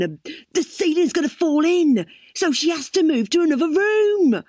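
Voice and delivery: a hint of a scheming husband; Dwayne's voice. A narrator's reading. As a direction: talk it through like the husband.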